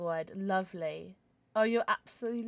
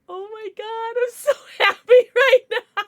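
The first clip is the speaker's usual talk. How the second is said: high, loud, laughing gush; laughing with joy; 13 semitones higher, 12 dB louder than usual. Real, not acted. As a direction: laugh through pure happiness. Overjoyed with new pet.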